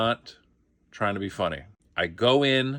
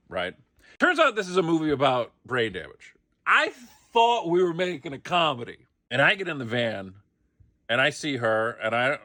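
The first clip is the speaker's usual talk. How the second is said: ordinary talk, nothing marked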